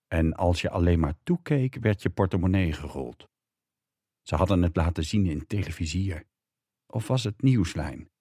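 ordinary delivery

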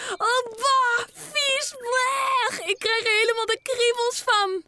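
put-on grossed-out boy jumping around; disgusted yelling. A narrator's reading. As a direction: shout and shudder, shaking it off. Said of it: "al roepend".

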